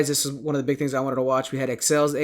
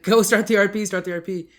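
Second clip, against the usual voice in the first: raised, higher mock yell, easing off on the repeat; retold yelling; 5 semitones higher, 5 dB louder than usual. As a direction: imitate spectators yelling urgent instructions. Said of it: "we were all yelling at the".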